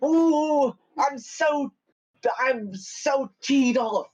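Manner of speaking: high, slow, huffy elf. Acted. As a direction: stretch it out as a sulking elf. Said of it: "big high elf voice".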